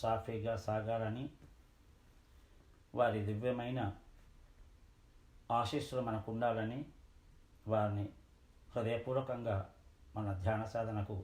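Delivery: low, measured phrases with long pauses; meditation invocation. Acted. Teacher invoking gurus' blessings for the group's practice, meditation invocation. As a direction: solemnly invite the gurus, pitch well down.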